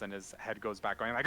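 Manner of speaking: ordinary delivery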